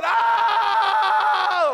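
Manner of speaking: loud, very high imitated baby scream; long piercing mock wail; newborn's scream. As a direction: scream like a newborn in full cry. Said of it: "That's what she's doing".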